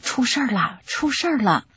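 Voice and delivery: a light imitation of workers yelling; mild retold shout. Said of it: "工人们大喊". (轻轻模仿工人惊叫)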